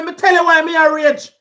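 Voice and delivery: usual speaking voice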